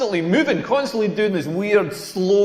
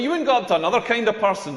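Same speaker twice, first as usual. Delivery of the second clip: usual speaking voice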